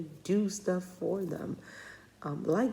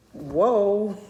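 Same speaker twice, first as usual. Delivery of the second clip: louder, higher, a laughing gasp of disbelief; laughing whoa; 5 semitones higher, 7 dB louder than usual. Retold or imitated. Mother stunned by her five-year-old's retort, laughing whoa. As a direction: caught off guard and amused. Recalling daughter's blunt refusal.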